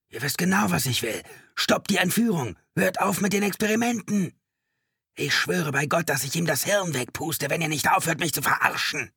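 high hysterical shouting; desperate hostage-taker's high-pitched yelling; crazed, frightened. A narrator's reading. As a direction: rant and threaten in panic. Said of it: "verzerrt von Angst und Wahnsinn".

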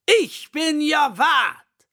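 big, slow, high roaring announcement; lion's roar. A narrator's reading. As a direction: bellow your name like a proud lion. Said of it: "brüllt der Löwe".

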